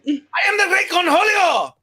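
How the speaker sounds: high, loud manic cartoon voice; Cornholio voice. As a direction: rant like a crazed cartoon teen. Cornholio impression again.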